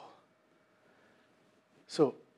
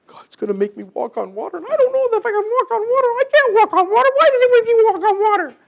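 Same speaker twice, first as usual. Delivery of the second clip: high, loud, fretful voice, up high throughout; whining like an anxious believer afraid of the task; hammed-up panicky complaint; mock worried whine; 10 dB louder, faster than usual. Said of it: "you don't need to be worried about".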